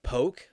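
"poke" rhyming with joke; one-syllable poke. Shown as a demonstration. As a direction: POKE said like the verb in "I poked you". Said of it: "like, I poked you".

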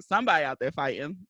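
ordinary talk

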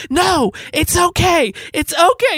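slow soothing no, it's okay; calming husband. Retold or imitated. Husband slowly soothing his angry wife. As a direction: ease her down gently and slowly. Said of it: "doing everything he can to try to calm me down".

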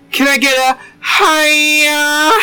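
very high, slow sing-song; silly high call. Retold or imitated. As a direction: stretch it out like a cartoon. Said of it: "me and my coworker fucking go".

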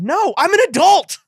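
high, incredulous squawk; indignant protest. Real, not acted. Host denying watching a kids' show, indignant protest. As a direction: protest high in mock offense.

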